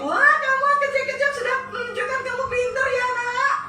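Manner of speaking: put-on high, fond praise of a little child; doting parent. Parent praising the youngest child, high and fond. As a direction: coo over the youngest like a proud parent.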